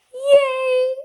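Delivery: high, loud squeal of joy; cheering yay at full pitch. A narrator's reading. The narrator cheers at Farrah's approval, high and loud. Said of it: "I cheer".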